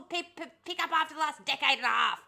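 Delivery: normal talking voice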